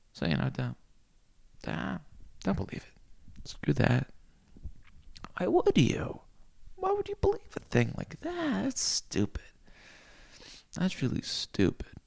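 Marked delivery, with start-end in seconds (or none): 1.6-3.5 a sudden unvoiced whisper; hushed warning. Mock hushed warning to listener. 5.4-8.6 much higher, squeaky mock question; mock disbelief. Mock incredulous high question. 8.6-11.9 unvoiced whisper; whispered scoff. Whispered dismissal.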